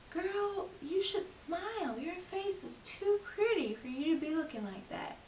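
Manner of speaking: high, drawn-out mock concern; mock smile-nagging. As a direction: coo it high, mimicking an unwanted commenter. Mimics people telling her to smile.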